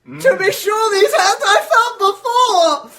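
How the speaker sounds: high, loud, slow exclamation; booming surprised delight; hammed-up recognition. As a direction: savour the discovery theatrically. Fezziwig recognizing wife.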